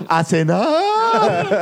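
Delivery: high, long-drawn holler; mock dinnertime yell, drawn very slow; joking call to dinner. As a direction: stretch it out high like a call to the table. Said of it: "o grita".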